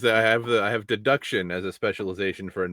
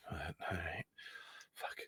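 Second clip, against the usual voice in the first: breathy resigned whisper; whispered aside; 3 semitones lower, 18 dB quieter than usual. Real, not acted. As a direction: mutter it under your breath. Resigned aside after bad feeling.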